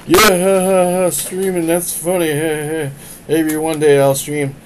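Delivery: high, loud, dismissive friend with fake laughs; mocking friend voice. Friend laughing off the streaming link, high and loud. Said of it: "gets back to me like".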